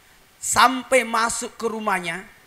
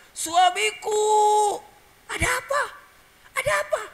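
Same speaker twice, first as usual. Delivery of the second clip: crying out; 14 semitones higher, 3 dB louder than usual. Retold or imitated. Halimah crying out to her husband at night, high distressed call. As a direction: call to your husband in a panic at midnight. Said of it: "menangis tengah malam, berteriak".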